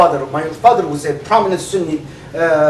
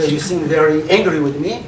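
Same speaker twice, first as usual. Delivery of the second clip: normal talking voice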